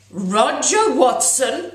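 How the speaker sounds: loud, high, slow teacher's shout of a pupil's name. Acted. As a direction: snap his full name across the room. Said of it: "she shouted".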